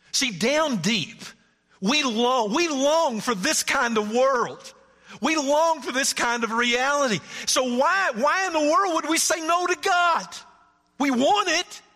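high, loud, slow pulpit cry; impassioned preacher climax; preaching. Acted. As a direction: push the longing up to a peak. Climax of the sermon.

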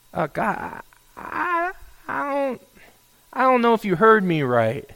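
high, halting, tentative voice; hesitant objection. Acted. Prophet doubting God heard him right, hesitant. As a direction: stammer it softly, pitched high, then ease down. Prophet timidly questioning God's answer.

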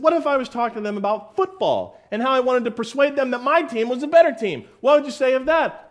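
a cheeky hypothetical pressed hard; mock challenge. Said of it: "he said".

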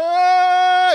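high, loud imitated baby wail. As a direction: wail like a crying infant. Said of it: "they are just crying".

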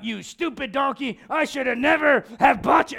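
acted cursing at a stubborn beast; mock angry rider. Preacher plays Balaam beating his donkey, mock angry. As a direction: scold the donkey in mock fury. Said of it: "And he's beating that thing".

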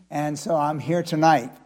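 ordinary talk, nothing marked

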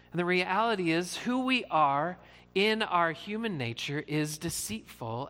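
normal talking voice

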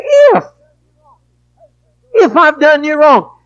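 crying out, voice cracking high and loud; anguished shout. Real, not acted. Preacher crying out at a half-hearted apology, anguished shout. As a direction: cry it out in pained outrage.

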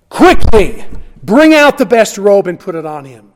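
loud, urgent call to the servants; a father shouting orders across the yard; father's urgent command. Acted. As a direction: call out to the slaves to hurry. Father calling to his slaves.

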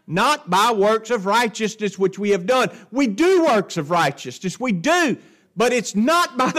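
loud, emphatic pulpit preaching; forceful sermon cadence. Real, not acted. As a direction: drive every phrase home to the congregation.

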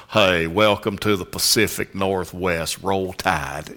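slow Southern drawl; Southern accent. Retold or imitated. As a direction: put on a drawling Southern welcome. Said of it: "people pick up on you being Southern".

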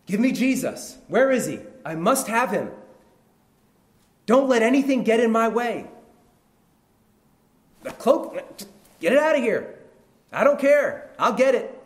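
high, loud, insistent shouting; urgent demanding. Acted. Blind man won't be stopped reaching Jesus, urgent demand. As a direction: shout past anything in your way.

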